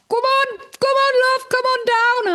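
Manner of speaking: hammed-up call from a bridge worker; mock workman calling. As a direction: wave her down like a cheery builder. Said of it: "the guy on the bridge was like".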